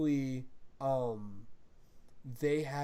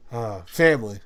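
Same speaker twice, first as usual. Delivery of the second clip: lower, a hint of a solemn action hero; mock gruff Vin Diesel; 4 semitones lower, 10 dB louder than usual. Mocking the movie's catchphrase, lower and solemn. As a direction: intone it gravely.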